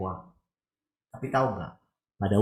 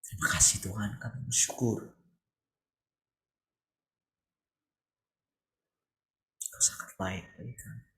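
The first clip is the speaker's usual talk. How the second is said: closing prayer. Real, not acted. Preacher leading the congregation in a closing prayer, reverent.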